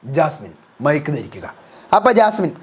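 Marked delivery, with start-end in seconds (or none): 0.0-1.5 deep, low announcer voice; low official house announcement; Bigg Boss announcer. Acted. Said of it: "big boss announce ചെയ്യുന്നു".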